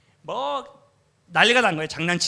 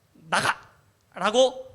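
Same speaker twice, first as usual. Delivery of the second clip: 화난 외침; 5 semitones higher, 5 dB louder, much slower than usual. Retold or imitated. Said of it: "제가 화가 나가지고".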